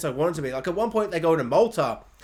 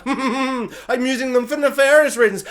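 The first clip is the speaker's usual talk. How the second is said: loud, higher villain chuckle and boast; evil mustache-twirling villain; 6 semitones higher, 5 dB louder than usual. Acted. Villain revealing nefarious reasons in his mansion, hammed-up evil. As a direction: ham up a scheming cartoon villain. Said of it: "an evil mustache-twirling villain's mansion".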